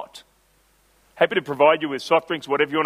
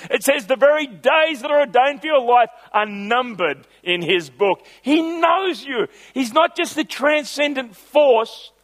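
higher, ringing, driving sermon voice; full-force preaching; 6 semitones higher than usual. Acted. Pastor building to a passionate close for the congregation. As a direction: preach it to the back row.

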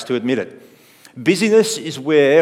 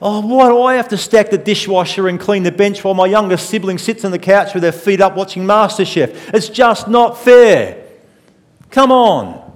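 higher, louder sulky whine, slowing on fair; whiny older sibling; 6 semitones higher, 5 dB louder than usual. Retold or imitated. Older sibling whining about the dishwasher, sulky and loud. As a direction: complain like a hard-done-by kid. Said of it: "you hear an older sibling saying".

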